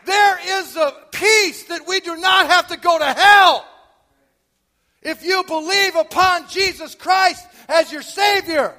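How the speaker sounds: loud, high, slow altar-call preaching; fiery preaching. Acted. Preacher urging his congregation toward hope, booming from the pulpit. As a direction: preach it hard, warning and pleading.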